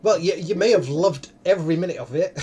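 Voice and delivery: ordinary talk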